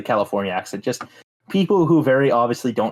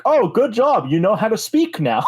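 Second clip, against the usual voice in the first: higher, condescending congratulations; patronising mock compliment; mock praise; 7 semitones higher than usual.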